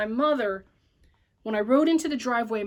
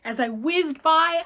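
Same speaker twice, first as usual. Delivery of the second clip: ordinary delivery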